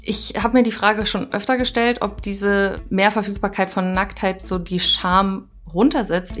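ordinary talk, nothing marked

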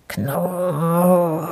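long, drawn-out rolling growl; bear growl. A narrator's reading. A very grumpy bear in his garden, growling. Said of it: "knurrte er".

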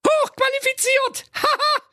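extremely high squeal breaking into laughter; shrieking laughter. Acted. Friends mocking the zebra, shrieking with laughter. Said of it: "vor Lachen und prusteten".

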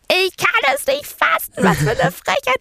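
very high squeaky indignant voice; mock outrage. Texting her best friend, a hammed-up squeaky outrage. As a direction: shriek your outrage in a silly high voice. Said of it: "war so".

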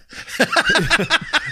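a bright burst of laughter; high laugh. Real, not acted. Santa laughs about rude celebrities, a high laugh.

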